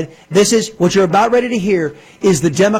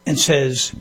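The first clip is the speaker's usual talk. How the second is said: usual speaking voice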